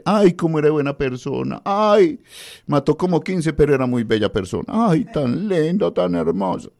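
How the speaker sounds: high, gushing, hammed-up mourner's wail; mock mourner. Radio host mocking funeral mourners, high gushing wail. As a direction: overdo the tearful praise of the dead.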